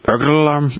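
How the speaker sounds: low, garbled go at Scottish; attempted Scottish accent. Friend testing if Scottish can say it, rough Scottish accent. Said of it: "Scottish people can't say burglar alarm".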